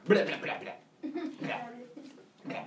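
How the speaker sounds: repeated comic retches, tailing off; mock puking. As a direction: spit out a string of retching noises. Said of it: "he pukes up five more times".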